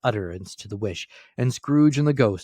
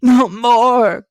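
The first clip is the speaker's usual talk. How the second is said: shouted, much higher and louder; crying out; 14 dB louder than usual. A narrator's reading. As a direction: cry out in desperation. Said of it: "cried Scrooge".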